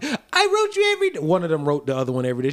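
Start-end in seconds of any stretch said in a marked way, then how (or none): 0.0-1.2 put-on high-pitched girlfriend voice. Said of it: "she's like".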